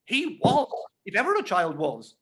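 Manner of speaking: emphatic, high and loud on He was. A narrator's reading. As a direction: hammer it home, emphatically. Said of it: "said my sister most emphatically".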